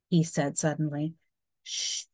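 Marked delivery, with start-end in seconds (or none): 1.6-2.0 a soft breathy hush to quiet the class; teacher hushing the noisy boys; hushing shh. A narrator's reading. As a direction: shush them gently. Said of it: "Missus Kelly whispered".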